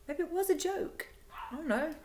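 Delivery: high, uncertain musing; second-guess yourself in a small, high voice; retold self-doubt. Voices her own past self-doubt.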